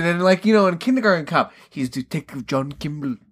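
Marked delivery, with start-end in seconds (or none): 1.7-3.2 low, soft mock-Austrian delivery; Arnold Schwarzenegger. Mocking the name of his cop role, mild Austrian accent. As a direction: lightly imitate an Austrian accent, dropped low. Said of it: "highlighting his Austrian roots".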